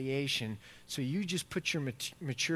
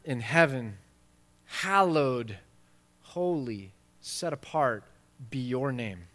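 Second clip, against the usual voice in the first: solemn prayer reading; 5 dB louder than usual. Acted. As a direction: pray it deliberately, phrase by phrase. Reads the Lord's Prayer.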